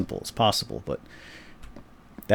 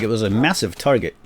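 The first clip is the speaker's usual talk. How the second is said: ordinary delivery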